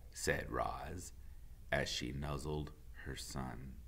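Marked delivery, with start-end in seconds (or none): none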